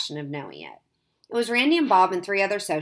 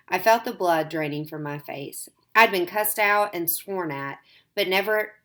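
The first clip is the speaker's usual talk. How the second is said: plain reading voice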